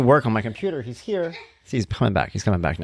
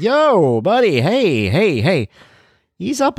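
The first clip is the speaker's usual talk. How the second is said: higher and louder, retold call to settle him; 9 semitones higher, 4 dB louder, much slower than usual. Dad retelling calling his excited son down, higher and louder. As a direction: hail him over the noise. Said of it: "I gotta be like".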